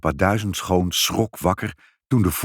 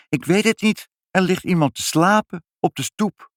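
put-on high, urgent little child; child's voice; 11 semitones higher than usual. A narrator's reading. Child tells puzzled parents of a sleeper, high and urgent. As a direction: answer high and insistent, like a child.

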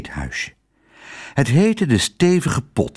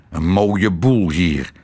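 lower, slow grumble; grumbling; 5 semitones lower, slower than usual. A narrator's reading. Admiral annoyed at the little eatery, low slow grumble. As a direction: growl it like a grumpy old admiral. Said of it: "knorde de admiraal".

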